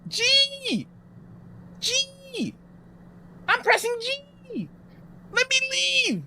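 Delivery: very high, frantic screams of "G!"; panicked screaming. Real, not acted. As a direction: scream for the key in terror. Panicking, game won't let her leave.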